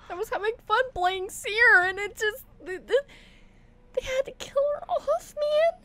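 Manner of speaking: very high, whining, upset voice; tearful complaint. Real, not acted. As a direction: voice climbing into a crying squeak. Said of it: "I am still crying".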